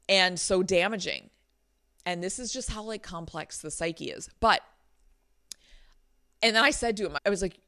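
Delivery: ordinary delivery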